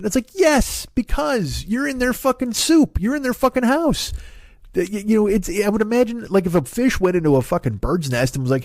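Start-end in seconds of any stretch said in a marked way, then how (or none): 0.0-6.3 exasperated rant. Real, not acted. Ranting at shark complainers.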